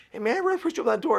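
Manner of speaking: high, loud and rapid gratitude; retold effusive thanks. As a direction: gush it quickly as the grateful stranger. Retold grateful stranger at the door.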